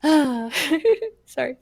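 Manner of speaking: sorry through laughter; laughing apology. Real, not acted. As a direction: giggle out an apology. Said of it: "I got excited".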